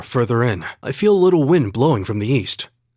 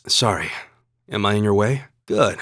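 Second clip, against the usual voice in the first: ordinary talk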